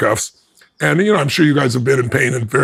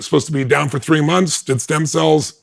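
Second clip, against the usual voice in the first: usual speaking voice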